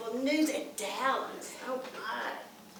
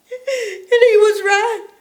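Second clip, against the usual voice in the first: crying through it; 6 semitones higher, 13 dB louder, slower than usual. Acted. Grandmother recalls a death.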